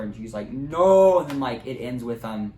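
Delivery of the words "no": much louder, higher despairing shout; anguished no. Acted. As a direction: cry it out in anguish. Vader's anguished cry.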